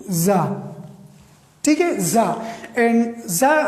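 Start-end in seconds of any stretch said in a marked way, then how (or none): 0.1-0.7 زا as one low syllable "zaa"; model pronunciation. Shown as a demonstration. Teacher reveals how TSAR is said, زا as low "zaa", deliberate model pronunciation. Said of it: "اس کی تلفظ کیا ہے؟". 2.0-2.3 زا as a low, single "zaa"; model pronunciation. Shown as a demonstration. Teacher repeats the answer for learners, زا as low "zaa", deliberate model pronunciation. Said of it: "اس کی تلفظ کیا ہے؟".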